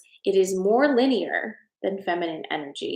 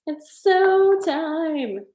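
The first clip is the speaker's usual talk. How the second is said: emphatic drawl; 9 semitones higher, 4 dB louder, much slower than usual. Retold or imitated. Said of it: "I'm like".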